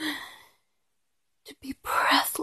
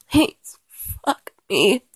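pleading; 6 dB louder than usual. Acted. Pleading climax line louder.

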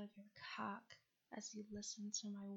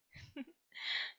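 breathy giggle; 7 semitones higher, 6 dB louder than usual. Acted. Teasing laugh mid-roleplay.

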